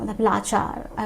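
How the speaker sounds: ordinary talk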